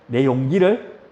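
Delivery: normal talking voice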